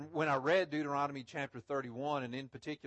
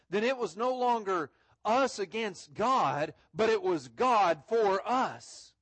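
louder and higher, slow preacher's build; fervent preaching; 4 semitones higher, 5 dB louder than usual. Acted. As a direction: preach it out to the congregation. Raised preaching climax.

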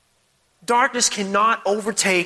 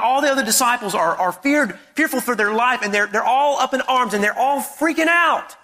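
ordinary delivery

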